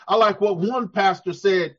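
ordinary talk

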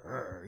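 low, soft growling "Arr"; mock aggressive growl. Preacher mocking fake boldness as meanness, mock growl. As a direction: growl like a bully pretending to be bold. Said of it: "That's just being mean".

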